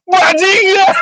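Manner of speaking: high-pitched shouted curse; friend's retold yell. Teller imitates the friend finally yelling, high shout. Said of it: "temanku yang teriak".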